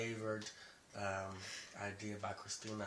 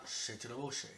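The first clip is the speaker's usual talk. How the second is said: ordinary talk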